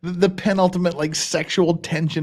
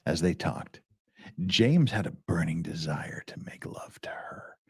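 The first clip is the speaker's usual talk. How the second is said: low, soft, half-whispered voice; hushed dramatic reading; 8 semitones lower, 7 dB quieter than usual. Shown as a demonstration. Reader resumes the lurid romance passage, hushed dramatic reading.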